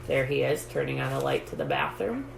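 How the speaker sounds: ordinary delivery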